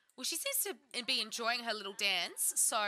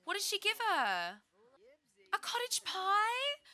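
delighted; 9 semitones higher than usual. Real, not acted. Delighted by the gift.

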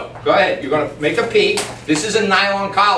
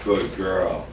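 low, soft praise to a dog; hushed, low approval; gentle praise; 11 semitones lower, 7 dB quieter than usual. Real, not acted. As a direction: soothe the dog quietly. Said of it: "I praise good behavior".

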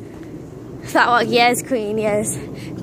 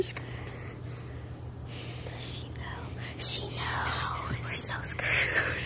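whispering; 16 dB quieter, much slower than usual. Real, not acted. Sneaking past someone, whispering.

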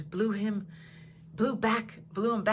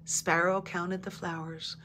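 plain reading voice

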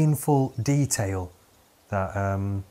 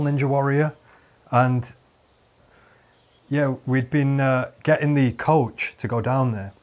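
ordinary talk, nothing marked

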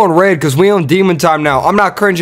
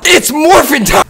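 imitating Power Rangers; 10 semitones higher than usual. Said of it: "It's morphine time".

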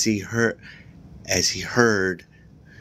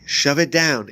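urgent command, a bit louder; instructing; 6 semitones higher, 4 dB louder than usual. A narrator's reading. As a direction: snap out the order. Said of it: "Andy instructed".

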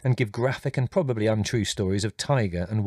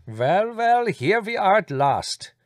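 bright, much higher greeting in a character voice, drawn out slowly; excited cry; 10 semitones higher, 5 dB louder, slower than usual. A narrator's reading. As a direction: burst onto the veranda with delight. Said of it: "he cried excitedly".

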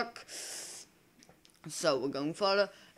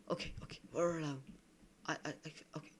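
whisper; 10 dB quieter, much faster than usual. Real, not acted. Sneaking alone into the tree.

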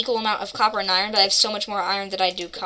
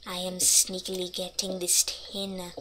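softer, hushed sneaky voice; 2 semitones lower, 8 dB quieter than usual. Shown as a demonstration. A player sneaking past mobs, hushed and sneaky. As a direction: keep it down like you're creeping past. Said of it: "I am sneakily talking sneakily".

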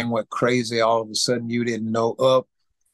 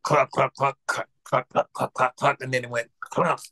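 rapid clucking engine noise; sputtering engine imitation. Telling how the engine failed, imitated sputter. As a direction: imitate an engine knocking, then dying.

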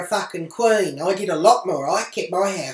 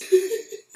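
high-pitched gloating giggle; high gleeful giggle; 4 dB louder than usual. Real, not acted. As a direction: giggle at your own mischief. Gloating laugh.

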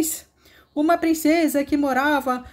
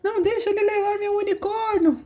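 princesa aflita; 6 semitones higher than usual. Acted. Princess begs to save unicorn.

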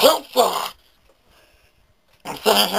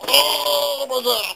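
drawn-out shout; 7 semitones higher, much slower than usual. Acted. Exasperated outburst over his face.

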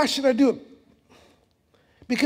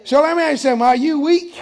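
loud question to the church; 4 semitones higher, 6 dB louder, faster than usual. Real, not acted. Pastor questioning congregation loudly.

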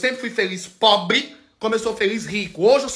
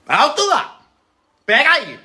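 high, louder mock halt call; mock interruption; 5 semitones higher, 3 dB louder than usual. Cutting off someone who claims to speak for Jesus, mock indignant. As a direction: call a sudden stop, pitched up.

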